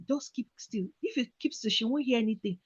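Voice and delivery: very soft, held-breath murmur; whispered self-talk. Retold or imitated. Hiding in the cupboard, telling herself very softly. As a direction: coach yourself silently to stay still. Hiding, trying not to be heard.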